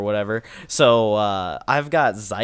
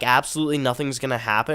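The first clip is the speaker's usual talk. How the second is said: ordinary delivery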